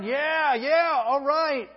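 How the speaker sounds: high, loud cheering, slowed on all right; loud, slow cheer of approval; cheering him on. Retold or imitated. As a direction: egg him on like a fan in the crowd. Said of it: "He's cheering him on".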